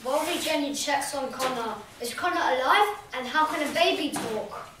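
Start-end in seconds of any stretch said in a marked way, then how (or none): none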